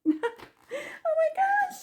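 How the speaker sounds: very high, slow squeaky laugh-talk; overwhelmed high giggly squeal; giddy laughter. Real, not acted. Overcome with excitement, laughing.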